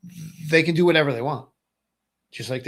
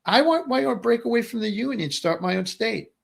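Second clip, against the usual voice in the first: higher-pitched mock governor musing; lightly voiced hypothetical governor, higher; voicing a governor; 8 semitones higher than usual. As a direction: play a governor daydreaming of breaking away, pitched up. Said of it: "the governors of each of the states will go".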